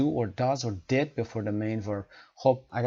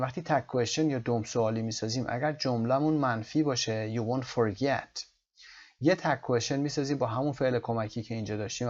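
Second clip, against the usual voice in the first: normal talking voice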